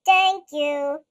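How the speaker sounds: low, loud booming giant; deep booming voice. Acted. Giant monster saying his first word, deep and booming. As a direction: rumble it like a gentle giant. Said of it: "in a deep, booming voice".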